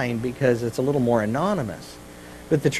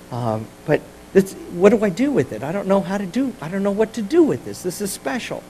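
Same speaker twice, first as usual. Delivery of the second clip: higher, softer, helpless questioning; acted bewilderment; 5 semitones higher, 5 dB quieter than usual. Recipient of God's wonderful gift wondering, acted bewilderment. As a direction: play someone at a loss over a precious gift. Acting puzzled receiver of a gift.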